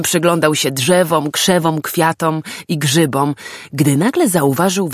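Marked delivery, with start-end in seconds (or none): none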